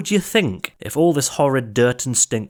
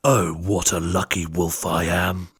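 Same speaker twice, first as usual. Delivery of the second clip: gloating wolf; 4 semitones lower than usual. Acted. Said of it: "He shouted".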